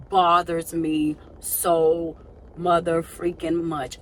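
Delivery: "bothers me so mother freaking much" slow and weighted; emphatic frustration. Real, not acted. Venting about having no control, "bothers me so mother freaking much" slowly stressed.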